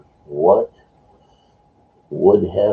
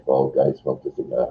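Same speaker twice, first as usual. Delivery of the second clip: ordinary talk, nothing marked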